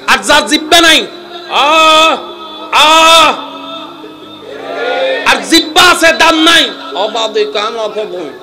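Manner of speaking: high, loud shouting sermon; fiery preacher at full voice; full-force preaching. Acted. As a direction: hammer each point to the crowd.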